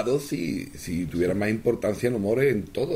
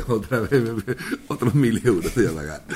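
laughing taxpayer. Retold or imitated. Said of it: "llega a Hacienda y dile".

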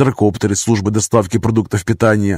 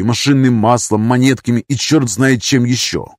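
plain reading voice

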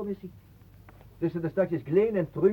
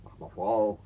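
ordinary talk

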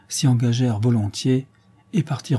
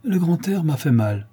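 high-pitched sheepish excuse; mildly ashamed brush-off; 6 semitones higher than usual. A narrator's reading. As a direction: cover up an embarrassing weakness. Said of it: "honteux de sa faiblesse".

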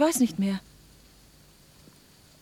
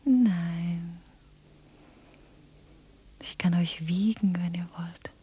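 soft, lower, slow voiceless words; lulling whisper; 3 semitones lower, 6 dB quieter, slower than usual. Acted. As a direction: whisper them to sleep. Anna lulls them to sleep. (einlullendes Flüstern)

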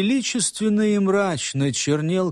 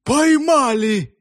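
higher, louder, slow shout; triumphant cry; 8 semitones higher, 5 dB louder, slower than usual. A narrator's reading. Constables bursting in with their catch, loud triumphant cry. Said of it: "вскрикнули вошедшие".

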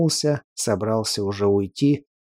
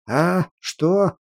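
booming louder than usual; 5 semitones higher than usual. A narrator's reading. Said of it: "прогремел Людогор даже громче обычного".

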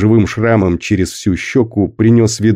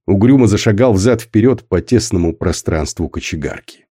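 plain reading voice

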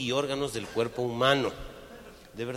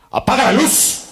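loud, high yell; shouted order; 13 semitones higher, 17 dB louder than usual. Acted. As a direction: bark the order to kill the light. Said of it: "le grita el güey". (grito: ¡apaga la luz!)

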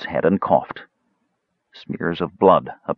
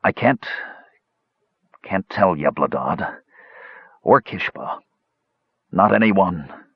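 wheezing; 3 semitones higher than usual. A narrator's reading. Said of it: "he wheezed".

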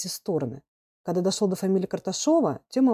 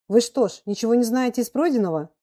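higher, louder reproachful question; irritated teacher; 6 semitones higher, 4 dB louder than usual. A narrator's reading. Teacher questions an unprepared pupil, irritated. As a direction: put the pupil on the spot.

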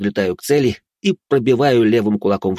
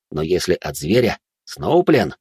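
usual speaking voice

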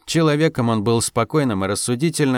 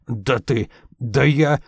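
angry huffing; slower than usual. A narrator's reading. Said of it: "злобно пыхтел Куркуль".

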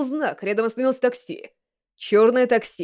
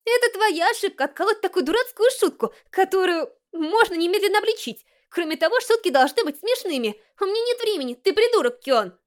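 put-on higher, scornful girl on the phone; Haruhi scolding; 8 semitones higher than usual. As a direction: scold him, then hang up.

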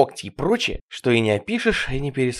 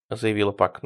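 plain reading voice

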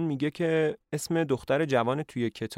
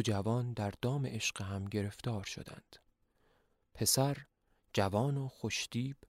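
softer, solemn book reading; reading from old book; 5 dB quieter than usual. Acted. Leon reading the curse book aloud, softly solemn. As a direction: read the chronicle like an old record.

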